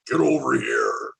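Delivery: Mortal Kombat Scorpion battle cry. Pretend. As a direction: bark it like the ninja spectre. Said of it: "you're a glorified scorpion".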